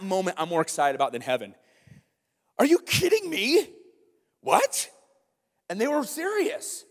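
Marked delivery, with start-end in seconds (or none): 2.6-4.9 sharp high cry of disbelief; can't believe what you heard; shocked disbelief. Real, not acted. Shocked at their answer.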